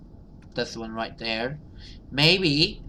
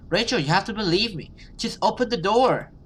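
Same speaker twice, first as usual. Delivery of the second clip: desperate pleading; 8 semitones higher, 3 dB louder, faster than usual. Acted. Monster pleads as little sister.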